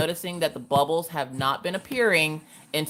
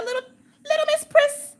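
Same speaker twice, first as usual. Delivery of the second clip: much higher, sing-song "little Miss Pris"; playful squeal. Pretend. Greeting a viewer by nickname, much higher sing-song. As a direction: tease the name up high.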